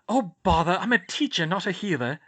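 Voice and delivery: a fussy teacher out of her depth; lightly voiced flustered teacher. Sprout, unhappy, flustered.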